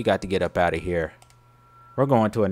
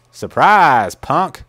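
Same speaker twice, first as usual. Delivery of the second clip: loud mocking surprise; loud taunt; 4 semitones higher, 9 dB louder, much slower than usual. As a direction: yell it at the victim. Taunting victim after scare.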